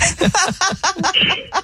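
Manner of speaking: high giggling laugh; burst of laughter. Real, not acted. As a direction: laugh out at the silly idea. Host laughs at theme.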